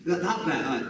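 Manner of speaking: mock excited director. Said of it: "I was like".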